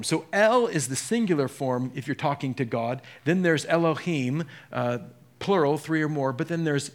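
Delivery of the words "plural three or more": normal talking voice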